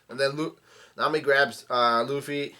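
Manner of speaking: ordinary delivery, nothing marked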